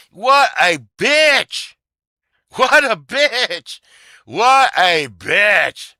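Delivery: loud and high, dragged out very slowly; angry outburst. Real, not acted. Venting to listeners about the woman, loud and slow. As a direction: spit it out slowly, each word stretched.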